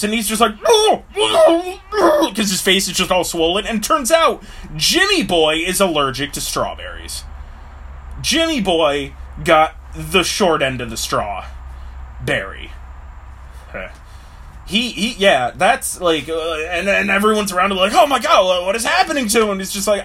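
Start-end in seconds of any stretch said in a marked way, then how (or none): 0.7-2.3 high, loud crying shout of pain; pained crying wail. Acted. Jimmy swollen with allergy. 17.9-19.5 high, fast, louder alarm; panicked bystanders. Acted. Onlookers panic at Jimmy.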